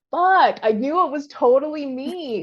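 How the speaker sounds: fuck, it was me all along; dawning realization. Real, not acted. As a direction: it hits you all at once. Sudden realization.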